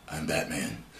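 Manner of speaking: low, soft Batman growl; gravelly Batman voice. Retold or imitated. Vlogger quoting Keaton's Batman line, low and soft. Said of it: "in his best gravelly voice".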